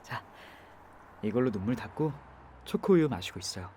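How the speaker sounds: 초코우유 said softly with a settled falling tune; gentle comfort. Acted. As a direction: coax her kindly to drink up. Soothing crying passenger.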